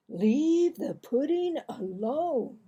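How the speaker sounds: lightly voiced mock stern father; mimicking dad. Boy imitating father's warning.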